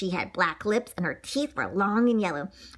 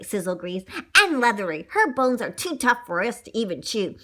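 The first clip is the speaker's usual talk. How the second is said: usual speaking voice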